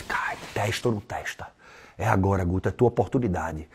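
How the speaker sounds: hushed inner voice, almost a whisper. Retold or imitated. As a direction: talk to yourself under your breath. Inner voice daring himself.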